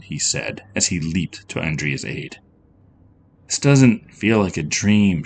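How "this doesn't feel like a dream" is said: plain reading voice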